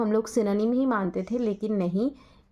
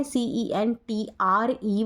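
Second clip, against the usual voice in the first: सीईएनटीआरई spelled out letter by letter, slow; slow spelling out; much slower than usual. Shown as a demonstration. Teacher spells सीईएनटीआरई slowly for students. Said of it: "TRE वाले British होंगे".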